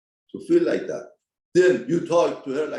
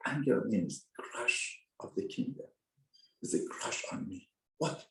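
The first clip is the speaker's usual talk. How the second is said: hushed intensity; 4 semitones lower, 9 dB quieter than usual. Real, not acted. Hushed explanation of anger.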